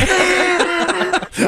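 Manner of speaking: high, full real laughter. Real, not acted. Host laughing at the caller's soda confession. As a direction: crack up helplessly.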